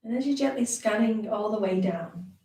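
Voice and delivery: calm, unhurried meditation guide with long pauses; guided meditation. Acted. Teacher leading beginners through a brief body scan, guided meditation voice. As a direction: ease listeners gently down through a body scan.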